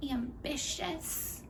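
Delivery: soft, slow and doubtful; sarcastically hesitant, drawn out; mock-tentative suggestion. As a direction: offer the word quietly, as if testing it. Sarcastic search for a kinder word.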